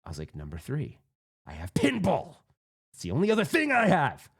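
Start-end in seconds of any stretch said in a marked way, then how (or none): 0.4-1.0 low, hushed build-up; dead serious. Retold or imitated. Player counting priorities for his friend, gravely serious. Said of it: "And I get serious". 1.5-4.3 mock desperate outburst. Player pleading pinball's importance to his friend, mock desperate. Climax of retold serious speech.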